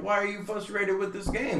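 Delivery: high, gentle parent voice; parent to toddler. Retold or imitated. Dad asking his upset daughter, high coaxing voice. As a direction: pitch it up for a small child.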